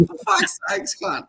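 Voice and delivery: high mock exasperation; a high-pitched groan of disbelief; exasperated. Meeting his mate by chance in the forest, high exasperated groan. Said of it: "And I'm like".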